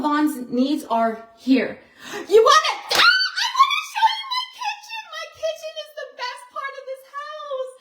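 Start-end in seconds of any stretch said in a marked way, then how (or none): 2.3-7.7 very high, fast squealing excitement; thrilled tearful squeal; overjoyed. Real, not acted. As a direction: gush about the kitchen, near tears. Showing off her kitchen.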